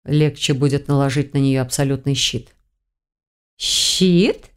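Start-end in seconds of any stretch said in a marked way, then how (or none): 3.6-4.5 drawn question swooping up; startled, rising query; astonished exclamation. A narrator's reading. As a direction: echo it in disbelief. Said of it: "в один голос воскликнули".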